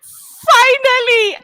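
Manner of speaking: fighting back real tears; real crying while explaining. Real, not acted. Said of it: "I am very emotional about this".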